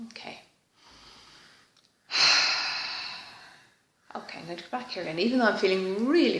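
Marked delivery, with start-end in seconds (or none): 2.1-3.3 a long, breathy release of air; deep unvoiced sigh out. Real, not acted. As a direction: let the breath go in a heavy sigh. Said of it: "I can feel a nice big sigh".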